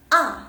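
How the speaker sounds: "A" high, very loud and abruptly falling; stamped fourth tone. Shown as a demonstration. Teacher shows fourth tone on A, stamped. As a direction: stamp out "A" in one hard drop.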